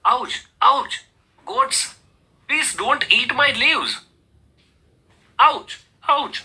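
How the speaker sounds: high yelps of mock pain; pained cries. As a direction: beg the goats while it hurts. Goats eat tree's leaves. (દુખાવાથી ઊંચા અવાજે બૂમ)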